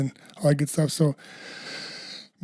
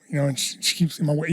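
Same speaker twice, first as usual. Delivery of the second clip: normal talking voice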